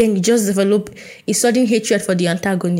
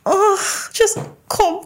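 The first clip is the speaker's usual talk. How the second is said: very high, gushing mock squeal; hammed-up emotional plea, pitched way up; overcome with delight; 11 semitones higher, much slower than usual. Reader calling fellow reader in for a hug, overcome.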